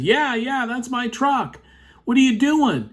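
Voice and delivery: high-pitched mock protest; agitated truck owner. Truck owner challenges tow driver, retold indignant protest.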